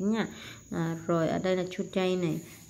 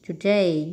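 Today with a punched, drawn-out second syllable; Today, weight on the final DAY; stress on second syllable; 5 dB louder than usual. Shown as a demonstration. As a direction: lean hard on DAY in Today. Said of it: "nhấn âm hai".

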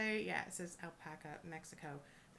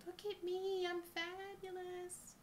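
showy abalone voice; 12 semitones higher, slower than usual. Pretend. Abalone pendant showing off to viewers, high diva squeal. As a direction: squeal it like a diva who wants all eyes on her. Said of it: "our mother of pearl going".

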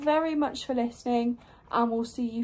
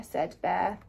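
plain reading voice

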